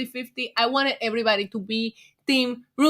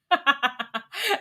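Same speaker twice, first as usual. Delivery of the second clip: laugh; 3 semitones higher than usual. Real, not acted. Laughing at own acting.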